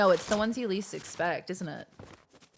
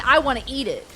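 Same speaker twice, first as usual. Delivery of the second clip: craving; 6 semitones higher, 9 dB louder than usual. Retold or imitated. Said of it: "And I'm thinking".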